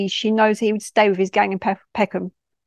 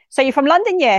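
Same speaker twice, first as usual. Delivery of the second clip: raised, higher-pitched challenge; loud challenge; 5 semitones higher, 4 dB louder than usual. Acted. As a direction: call it out to get her attention. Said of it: "he says loud".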